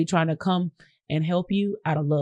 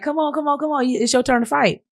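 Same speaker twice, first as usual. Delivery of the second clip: cousins urging; 8 semitones higher than usual. Retold or imitated. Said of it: "they be like".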